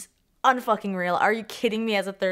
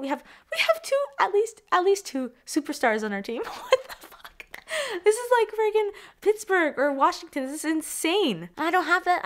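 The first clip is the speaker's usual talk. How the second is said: much higher pitched, squealing with excitement; giddy excitement; 9 semitones higher than usual. Real, not acted. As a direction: squeal like a fan who can't believe it. Fan overwhelmed about two superstars.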